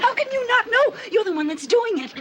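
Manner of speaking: high-pitched, exasperated accusation; incredulous and cross; indignant outburst. Acted. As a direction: throw the accusation at him, voice shooting up. Wife accuses husband of affair.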